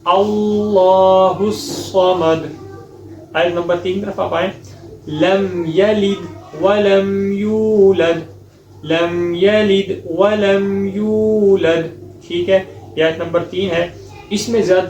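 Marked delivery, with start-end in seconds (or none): 0.0-2.5 tajweed chant; full recitation. Shown as a demonstration. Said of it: "مکمل کیسے ہی پڑھنا ہے". 5.1-11.9 slow, chanted verse; tajweed recitation; Quran recitation. Shown as a demonstration.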